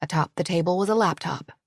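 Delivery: ordinary delivery, nothing marked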